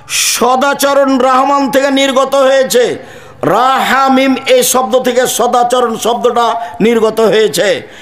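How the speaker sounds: preacher reciting scripture to the crowd; high preaching recital. Acted. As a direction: proclaim the words of the Prophet. Reciting hadith at full force.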